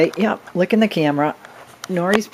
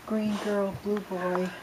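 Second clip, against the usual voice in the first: normal talking voice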